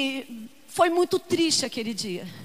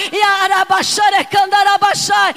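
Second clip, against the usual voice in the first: loud ecstatic speaking in tongues; fervent glossolalia in the sermon; shouted tongues; 3 semitones higher, 11 dB louder than usual. Acted. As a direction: burst into tongues at full voice. Loud tongues during preaching.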